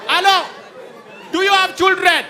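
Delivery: high, loud call upward; shouting up. Retold or imitated. Officer calling up to the trapped man, a high loud shout. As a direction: shout up to the fourth floor.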